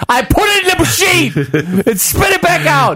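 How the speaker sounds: angry driver yelling at the booth attendant; retold yelling. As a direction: yell it up at the booth lady. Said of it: "So I'm yelling at this woman".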